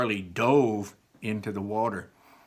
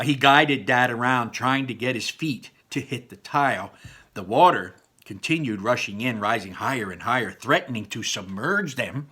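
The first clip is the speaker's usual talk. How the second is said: ordinary delivery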